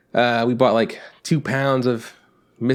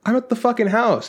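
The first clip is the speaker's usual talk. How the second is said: exasperated retort; 10 semitones higher than usual. Retold or imitated.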